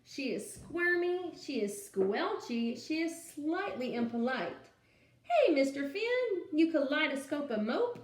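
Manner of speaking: lively storytime read-aloud; animated picture-book reading; reading to children. Acted. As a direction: read it out to a class of little kids.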